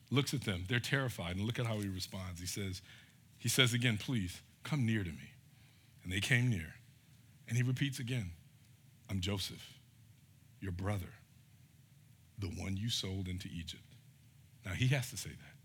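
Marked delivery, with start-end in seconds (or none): 4.0-5.3 softer, gentle invitation; gentle plea. Retold or imitated. Joseph gently invites his frightened brothers closer, softly. 9.1-13.9 gentle reveal. Retold or imitated. Joseph quietly tells his brothers who he is, tender.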